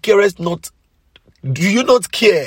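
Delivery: high, loud, slowing plea; shouted plea. Retold or imitated. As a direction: cry out to the Lord in the storm. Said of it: "They shouted".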